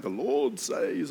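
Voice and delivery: slow, solemn prophet proclaiming; drawn out like an old prophet. Retold or imitated. Preacher mimics prophets announcing, slow solemn proclamation. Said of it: "no prophets running around going".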